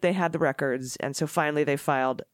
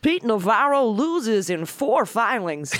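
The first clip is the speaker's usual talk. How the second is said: sportscaster voice; 5 semitones higher than usual. Acted. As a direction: call it like a ballgame announcer. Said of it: "my sportscaster voice".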